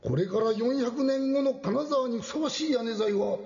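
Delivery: high, booming feudal lord proclamation; Lord Maeda Toshiie. Acted. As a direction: address the crowd like a samurai lord. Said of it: "前田利家公になったつもりで言ってみたい".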